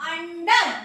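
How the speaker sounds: अंडर opening on a short "uh"; short u sound. Shown as a demonstration. Teacher reading the example to pupils, short u sound. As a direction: say अंडर with "uh", no "yoo". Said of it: "आ का sound आएगा".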